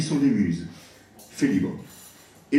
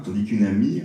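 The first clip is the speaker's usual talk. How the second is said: ordinary talk, nothing marked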